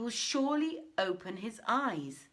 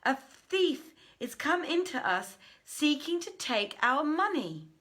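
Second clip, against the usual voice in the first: loud pleading cry to the crowd; 8 semitones higher, 6 dB louder than usual. Acted. Blind men crying to the crowd, loud and urgent. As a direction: call out for help, raised and urgent.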